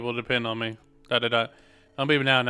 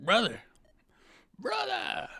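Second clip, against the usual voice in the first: little sister calling; 11 semitones higher, 3 dB quieter, slower than usual. Acted. Little sister calling her brother.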